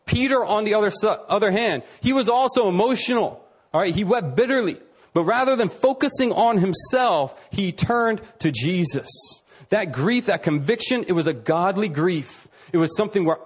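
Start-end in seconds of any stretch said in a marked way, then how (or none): none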